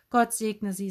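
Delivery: ordinary delivery, nothing marked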